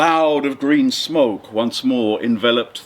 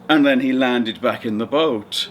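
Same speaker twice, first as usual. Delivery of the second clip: normal talking voice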